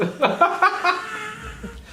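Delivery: high-pitched stuttering noise; imitated noise. Speaker acting out a noise they made, high imitated noise. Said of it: "f***ing a noise. I was like".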